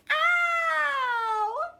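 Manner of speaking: long, high, loud "ow" scream; pained scream. Acted. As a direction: scream as the needle goes in. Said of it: "I scream and cry".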